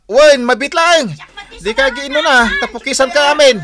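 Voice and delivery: loud, high call over the noise; shouting to kids. Acted. Waldo answers the crowd of nephews waiting for haircuts, shouting. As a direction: holler so every child hears.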